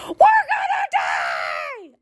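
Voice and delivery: high, drawn-out scream of panic; terrified scream. Retold or imitated. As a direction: shriek it like the car is about to crash. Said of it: "is screaming bloody murder".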